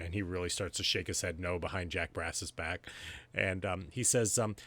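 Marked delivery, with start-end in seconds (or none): none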